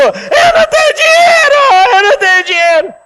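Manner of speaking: pai fazendo birra de brincadeira, agudo e gritado; pai imitando birra. Acted. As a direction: imite a criança chorando alto. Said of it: "o pai deitou do lado dele e começou". (pai deitado no chão imitando o filho, choro gritado)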